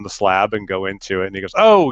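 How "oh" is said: loud, high-pitched oh; retold sudden realization; surprised oh. Technician reacts on seeing him.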